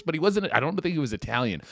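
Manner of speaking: ordinary talk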